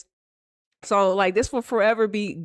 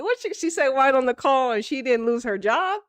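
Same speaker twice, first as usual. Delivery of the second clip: ordinary talk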